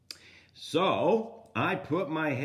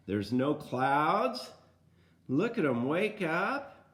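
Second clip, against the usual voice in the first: usual speaking voice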